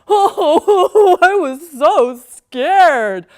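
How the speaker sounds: milking it for sympathy; mock sobbing. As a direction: ham up a crybaby's tale of woe. Paula fake-sobbing to mom.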